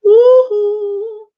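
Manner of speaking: a high, loud, sung woohoo; sung whoop. Real, not acted. Streamer singing out a loud woohoo over the music. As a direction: cheer it out like singing along.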